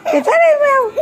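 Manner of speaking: high sing-song coaxing; coaxing kitty voice. Acted. Mother coaxing the pretend cat, high and cooing. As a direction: sweet-talk the cat in a high voice.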